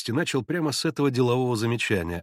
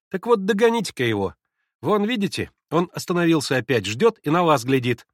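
put-on raised, louder boyish voice; boy egging on; 5 semitones higher, 5 dB louder than usual. A narrator's reading. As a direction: goad him like a schoolboy. Мальчик подначивает Алёшу. (задорный мальчишеский голос)

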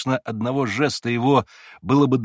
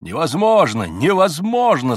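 outraged cries; 5 semitones higher, 5 dB louder than usual. A narrator's reading. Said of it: "слышалось в келе со всех сторон".

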